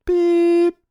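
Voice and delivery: very high loud beep; a sharp squeaky beep like voicemail; imitated answering machine beep. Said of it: "after the beep".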